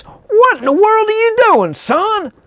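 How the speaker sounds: king scolding Isaac, high-pitched and indignant. Retold or imitated. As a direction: tear into him like a furious old king. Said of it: "He says".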